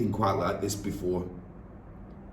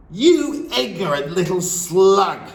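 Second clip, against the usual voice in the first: loud, slow, high bellow; 10 semitones higher, 8 dB louder, slower than usual. Acted. Headmistress bellowing insults at the boy, loud and slow. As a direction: spit the insult out slowly. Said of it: "the Trunchbull bellowed".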